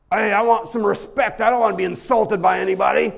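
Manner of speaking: loud, offended demand for respect; huffy, loud and affronted; indignant. Acted. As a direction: bristle like someone just insulted. Mock offended churchgoer quote.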